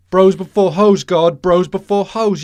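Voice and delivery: loud indignant bro chant; shouting. Acted. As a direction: yell it like a betrayed buddy. Mock outrage at betrayal.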